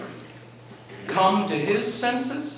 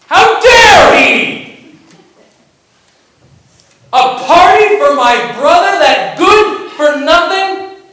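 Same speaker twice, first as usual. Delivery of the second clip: furious indignant shout, much higher and louder, slow; angry outburst; 8 semitones higher, 9 dB louder, slower than usual. Retold or imitated. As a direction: rage at the unfairness, shouting. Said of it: "And he gets angry".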